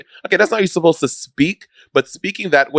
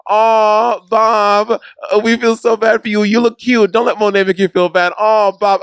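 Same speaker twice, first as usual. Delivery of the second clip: higher, cooing pity for poor Bob; exaggerated "aw, you poor thing"; mock sympathy; 7 semitones higher than usual. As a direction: gush fake sympathy like a doting fan. Mimicking sympathetic comments from fans.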